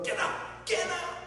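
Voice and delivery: hammed-up furious yell, jumping high on the repeat; mock outraged shout; blowing a gasket. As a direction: play someone exploding and throwing a passenger out. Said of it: "George blew a gasket".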